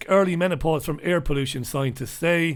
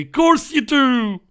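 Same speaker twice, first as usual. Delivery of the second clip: loud, high, slow scoff at the banks; sarcastic outburst; 9 semitones higher, 7 dB louder, slower than usual. Real, not acted. Scoffing at the Bank of America CEO, loud and sarcastic. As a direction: throw it back at him mockingly. Mocking the bank CEO's claim.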